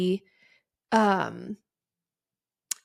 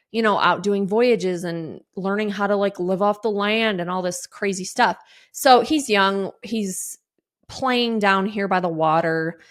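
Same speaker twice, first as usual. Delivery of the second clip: usual speaking voice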